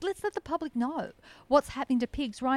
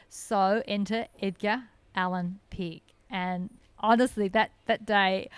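ordinary delivery

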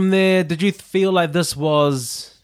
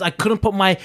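usual speaking voice

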